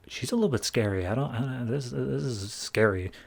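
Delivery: hushed, halting mock nervousness; unnerved aside. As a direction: say it under your breath, acting spooked. Group unnerved by the threat.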